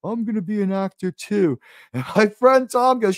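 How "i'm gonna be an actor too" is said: naive hopeful actor. Retold or imitated. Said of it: "And he goes".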